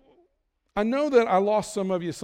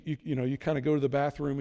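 ordinary talk, nothing marked

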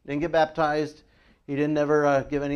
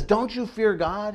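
higher-pitched mild scolding; rebuking; 7 semitones higher, 3 dB louder, slower than usual. A narrator's reading. Preacher voicing one criminal rebuking the other, rebuking. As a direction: reprove him with a raised pitch. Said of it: "he rebukes the other".

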